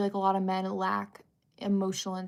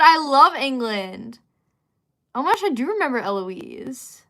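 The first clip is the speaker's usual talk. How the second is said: gushing enthusiasm; 4 semitones higher, 8 dB louder than usual. Real, not acted. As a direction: gush it, stretching the words. Gushing answer to a viewer's question.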